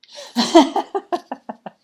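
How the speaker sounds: loud, high real laugh; bright burst of laughter. Real, not acted. Speaker laughs after the channeled message ends. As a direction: let out a big relieved laugh.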